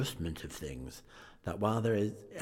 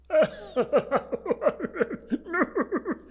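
long, high peal of laughter; hearty acted laugh; 6 dB louder than usual. Scrooge's nephew helpless with mirth, high rolling laugh. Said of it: "Laughed Scrooge's nephew".

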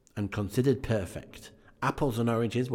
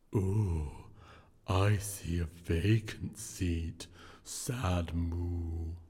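low, slow ghostly drawl; unearthly voice; 3 semitones lower, much slower than usual. Acted. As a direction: intone it like a solemn spirit. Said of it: "replied the ghost".

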